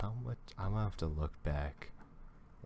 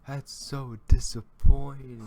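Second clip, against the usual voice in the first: exasperated outburst; 9 semitones higher, 14 dB louder than usual. Real, not acted. Let down by the video ending.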